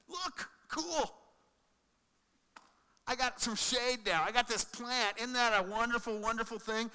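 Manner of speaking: put-on a kid thrilled with a new toy; delighted Jonah. Preacher voicing Jonah pleased with his shade, giddy.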